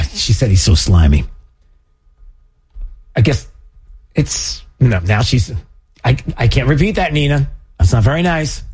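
ordinary delivery

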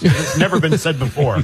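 ordinary talk, nothing marked

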